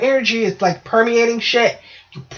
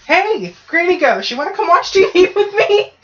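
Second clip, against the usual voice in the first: cheery calling out; 9 semitones higher, 3 dB louder than usual. Acted. Inviting Granny Ghost over for TV, cheery calling out. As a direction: call out brightly with a laugh creeping in.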